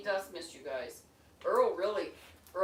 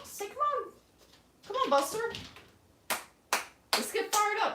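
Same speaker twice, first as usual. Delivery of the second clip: much higher, cheering coaxing; pep up the pet in a high voice. Real, not acted. Coaxing the pets.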